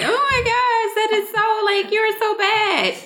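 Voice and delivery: squealing, laughing friend voice; high-pitched friend voice. Retold or imitated. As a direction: pitch way up, scandalized and giggly. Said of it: "And she's like".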